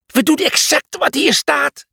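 very high, strained call; high-pitched defensive shout. A narrator's reading. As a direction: cry out over the storm. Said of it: "riep Cornelius".

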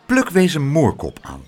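plain reading voice

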